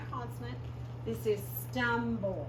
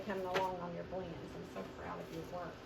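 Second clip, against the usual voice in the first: softer, quick proud praise to the class; gentle praise; 5 semitones lower, 8 dB quieter, faster than usual. Real, not acted. Teacher praising students.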